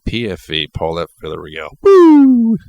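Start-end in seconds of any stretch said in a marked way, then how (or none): 1.8-2.6 loud high whoop of joy; excited shout. Real, not acted. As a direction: let out a big celebratory woo.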